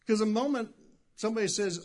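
ordinary talk, nothing marked

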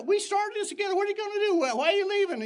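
high, pleading voice of departing members; pleading quote. Retold or imitated. Church members begging their pastor to stay, pleading. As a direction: plead with him, pitch way up.

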